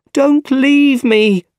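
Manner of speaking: very high, loud, drawn-out plea; frightened call. A narrator's reading. Aunt to nephew as he runs, frightened call. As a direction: call out desperately after him. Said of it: "called Aunt May".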